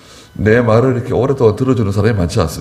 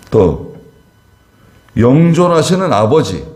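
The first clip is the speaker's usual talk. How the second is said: 높고 힘차게 외치는 설교; 하나님의 능력을 높여 선포하듯; preaching; 4 semitones higher than usual. Acted. 힘차게 선포하는 설교. (회중에게 하나님을 선포하며 높고 힘찬 설교 목소리)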